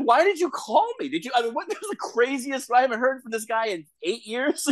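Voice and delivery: high-pitched, baffled protest; retold incredulity. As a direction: voice shoots up in mock outrage at the caller. Retold baffled reply to club owner.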